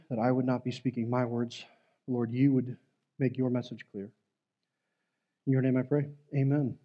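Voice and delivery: hushed, earnest prayer voice. Acted. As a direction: pray quietly and sincerely to God. Opening prayer before sermon.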